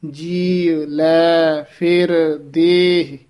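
slow "ਜੀ, ਲੈ, ਫਿਰ, ਦੇਹ", each word separate; word by word, like counting. Shown as a demonstration. Teacher modelling "ਜੀ ਲੈ ਫਿਰ ਦੇਹ" like counted numbers, slow and separate. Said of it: "ਗਿਣਤੀ ਨਾਲ ਵਾਚਕ ਸ਼ਬਦ ਹੁੰਦੇ ਨਾ".

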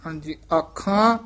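ordinary talk, nothing marked

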